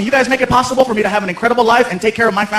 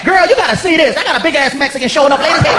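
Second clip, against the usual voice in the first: higher, laughing sassy voice; mock the guest to your coworker; gossiping clerk; 5 semitones higher than usual.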